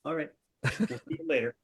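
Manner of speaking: normal talking voice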